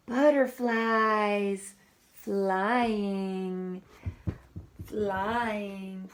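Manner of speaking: very slow, stretched out; reading to a child, drawn out; dreamy slow. Acted. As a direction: float the words like butterflies.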